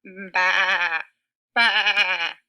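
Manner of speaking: a loud, shouted baa, baa; loud sheep bleat. Acted. Teacher playing Sheep for the kids, loud bleat. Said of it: "bleated Sheep".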